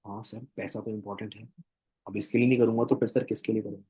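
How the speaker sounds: softly voiced, low acted answer; meek prospect reply. Prospect conceding to the coach that money matters, meek and quiet.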